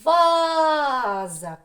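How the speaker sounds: Ваза with a long, high, loud first а; stress on first syllable. Shown as a demonstration. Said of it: "Куди буде наголос?". (Ваза з протяжним голосним наголосом на перше а)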